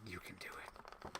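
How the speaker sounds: soft unvoiced whisper, slow; whispered encouragement. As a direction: breathe it like a secret cheer. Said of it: "Captain Iron Dog is like".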